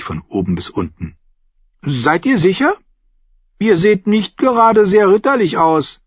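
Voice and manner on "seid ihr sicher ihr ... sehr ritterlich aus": put-on high child's voice, sceptical; high-pitched boy, unconvinced; doubtful boy. A narrator's reading. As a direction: question him as a high-voiced little lord.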